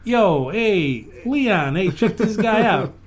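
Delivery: high, loud hyped-up street voice; snake buddies calling out. Pretend. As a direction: call your buddy over, pumped.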